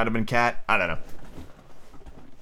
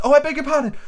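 put-on very high girlish apology; high-pitched alarmed politeness; crying Alice; 4 dB louder, faster than usual. A narrator's reading. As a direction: cry out as a flustered little Alice. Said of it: "cried Alice again".

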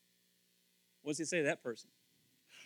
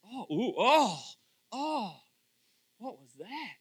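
disgusted; 5 semitones higher, 4 dB louder, much slower than usual. Retold or imitated.

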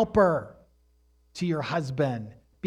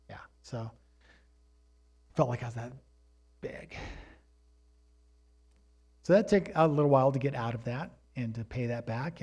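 ordinary talk

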